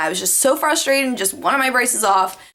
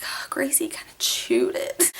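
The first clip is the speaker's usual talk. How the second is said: guilty, softer whispered admission; sheepish whisper; 7 semitones lower, 6 dB quieter than usual. Retold or imitated. Daughter confessing to her mom about the dog, sheepish whisper. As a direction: mumble the confession under your breath.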